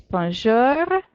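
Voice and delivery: Bonjour with a light growled r at the end; French bonjour. Shown as a demonstration. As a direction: bonjour à la française. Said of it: "Get your growly bears".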